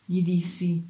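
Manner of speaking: ordinary delivery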